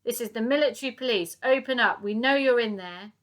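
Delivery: loud, commanding police voice; shouting. Acted. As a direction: bark it through the door. Police shouting through door.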